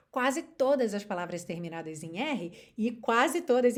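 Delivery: ordinary delivery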